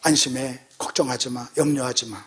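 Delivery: low, soft, calming quote; reassuring Paul. Acted. Paul calming frightened shipmates, soft reassurance. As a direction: settle it low and gently as reassurance. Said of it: "뭐라고 이렇게 얘기합니다".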